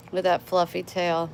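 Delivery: usual speaking voice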